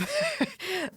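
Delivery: a quick high laugh; high breathy laugh. Real, not acted. Podcaster laughing at her own quarantine gripe, high laugh.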